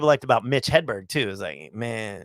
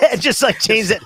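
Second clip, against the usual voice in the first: ordinary talk, nothing marked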